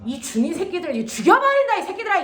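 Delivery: loud, high-pitched outburst of mock rage; mock threat. Teacher scolding students over a botched proverb, exasperated mock fury. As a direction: blow up at the class, voice leaping up.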